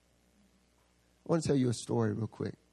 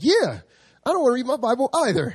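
high, louder, quick mock whine; whiny agreement; 11 semitones higher, 8 dB louder than usual. Zach backing up his friend against Bible reading, high mock whine. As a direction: chime in like a sulky kid. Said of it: "Zach's like".

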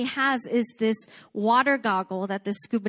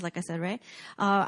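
ordinary talk, nothing marked

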